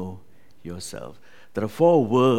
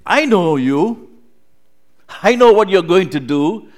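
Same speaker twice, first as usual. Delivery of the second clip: knowing friend; 8 semitones higher, 13 dB louder than usual. Retold or imitated. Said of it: "Sometimes our friends will say".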